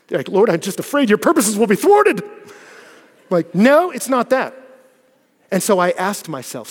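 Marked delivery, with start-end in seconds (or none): 0.3-2.2 high, loud, overblown fearful prayer; hammed-up holy worry, played for laughs; mock pious dread. Mock pious fear, then denied. 3.5-4.6 loud, high, drawn-out "no"; emphatic denial. Acted. Bluntly rejects his mock prayer.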